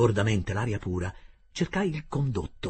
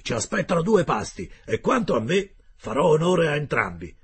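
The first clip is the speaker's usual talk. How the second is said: gruff harpooner Ned; 6 semitones higher, 7 dB louder than usual. Acted. Ned Land's voice in dialogue.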